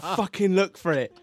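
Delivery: comic exasperated shout; mum's angry order. Retold or imitated. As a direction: imitate mum bellowing at the kids. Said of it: "My mum was like".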